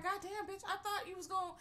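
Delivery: high, mock exasperated Helen; mock annoyed Helen. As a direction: pitch it high like fed-up Helen. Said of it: "She's like".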